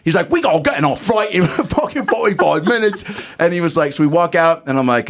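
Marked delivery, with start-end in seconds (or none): 0.3-3.1 put-on high-pitched caricature British accent; hammed-up British voice; little British man. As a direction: play a tiny British manager, pitched high. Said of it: "like, little British".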